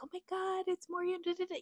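high, hushed fangirl squeal; giddy gushing. Retold or imitated. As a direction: imitate her giddy excitement, high and soft. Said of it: "She was just like".

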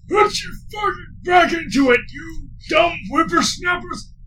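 put-on high, loud old man bellow; old drill sergeant shout. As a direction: roar at the lazy kids like a cranky old trainer. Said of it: "you hear me out".

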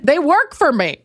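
high, dismissive diva voice; uncaring diva. Acted. Diva dismissing people she hurt, high entitled voice. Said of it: "You're like".

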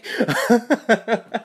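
high giggling laugh; laughter. Real, not acted. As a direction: laugh at your own joke. Laughing at own story.

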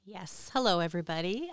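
ordinary talk

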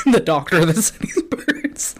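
slow, laughing mock repeat. Real, not acted. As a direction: giggle through the words. Mocking repeat while laughing.

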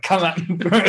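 smug taunt from the overpowered hero; cocky taunt. Pretend. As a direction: dare them to come at you.

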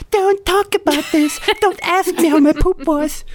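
very high, squealing falsetto imitation; hammed-up high-pitched panic; mock freak-out. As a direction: imitate someone freaking out, voice shooting way up. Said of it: "like, freaking out".

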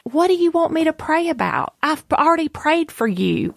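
high-pitched, retold exasperation; frustrated. Friend answering a repeated prayer request, frustrated. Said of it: "she was getting kind of frustrated".

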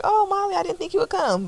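high, sugary imitation of a friend; mocking fake-sweet voice. Friend's fake-sweet greeting mocked.

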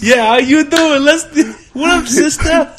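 high, loud, hammed-up cool-guy voice; Fonz impression. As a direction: belt out a joking swaggering greeting. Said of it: "sounds like the Fonz".

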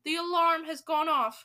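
excited alarm announcement; mild shriek. A narrator's reading. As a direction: shriek it with some restraint. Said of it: "shrieked the Grand High Witch".

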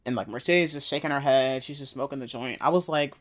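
ordinary talk, nothing marked

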